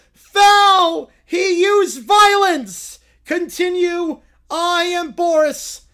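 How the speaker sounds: loud, slow referee bellow; booming Boris ruling. Acted. Boris the referee rules on the foul, booming and slow. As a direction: call the foul like it's a royal decree.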